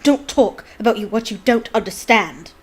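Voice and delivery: deranged anger. Acted. Snape deranged, loud.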